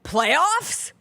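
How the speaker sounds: incredulous rising Playoffs?; lightly voiced disbelieving coach; Jim Mora impression. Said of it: "Give me Jim Mora".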